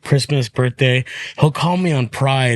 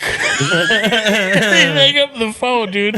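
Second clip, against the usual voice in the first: laughing; 9 semitones higher, much slower than usual. Real, not acted. Laughing while telling.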